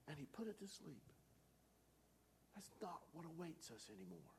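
soft, high, strained hush; hushed preaching. Real, not acted. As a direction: drop to an intense near-whisper. Hushed climax of crucifixion sermon.

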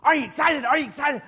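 high, loud, pumped-up questions; hammed-up instructor cheering him on; Gabe's hype. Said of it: "He says".

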